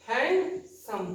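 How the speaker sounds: Handsome broken by a pause into hand and सम; syllable split. Shown as a demonstration. Teacher counting two syllables, handsome split in halves. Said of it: "the two syllable".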